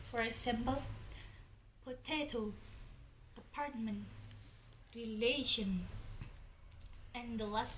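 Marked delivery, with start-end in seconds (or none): 1.8-5.9 "potato," "apartment," "relation," each with the middle syllable strongest; middle-syllable stress. Shown as a demonstration. Teacher giving examples of "potato," "apartment," "relation," middle-syllable stress. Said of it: "stress word is in the middle".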